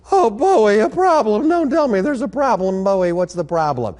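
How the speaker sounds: put-on high, whiny girlish protest; pleading. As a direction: coax him, pouting and dismayed. Ruth pleads with Boaz.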